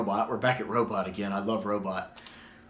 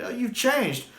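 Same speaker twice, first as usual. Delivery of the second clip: mock Doctor quote; 11 semitones higher, slower than usual. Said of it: "I love that".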